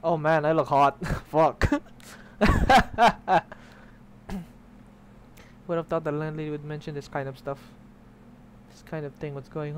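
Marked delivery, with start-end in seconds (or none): 2.1-3.5 loud, high burst of laughing; real laughter. Real, not acted. Player amused by his own look, loud high laugh. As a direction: crack up out loud.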